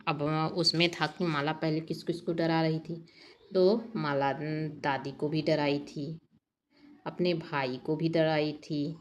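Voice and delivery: ordinary talk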